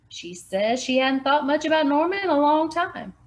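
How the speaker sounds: a hint of a man's put-on voice; lightly voiced Barney. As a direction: report it flatly to her. Barney voiced in different timbre.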